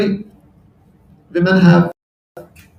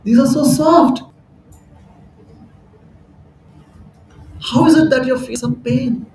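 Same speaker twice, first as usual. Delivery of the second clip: puzzled Lakshmi; 7 semitones higher than usual. Retold or imitated. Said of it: "she's like".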